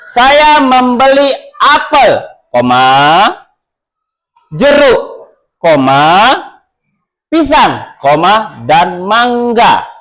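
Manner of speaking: slow teaching read of a comma list; list read with comma pauses. Shown as a demonstration. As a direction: show the comma breaks between "apel", "jeruk", "pisang" and "mangga". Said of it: "Beda pengucapannya".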